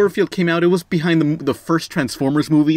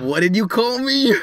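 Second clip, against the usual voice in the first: mock offended; 8 semitones higher than usual.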